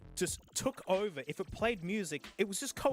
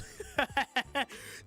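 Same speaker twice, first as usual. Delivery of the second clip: wheezing laugh; 5 semitones higher than usual. Real, not acted. Laughs at ugly extension.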